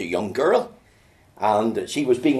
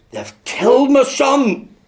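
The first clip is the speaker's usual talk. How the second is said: loud, slow wail, higher and breaking with grief; weeping cry; 13 semitones higher, 7 dB louder, slower than usual. Retold or imitated. Grieving father at the preacher's door, loud slow wailing cry. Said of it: "And in his weeping".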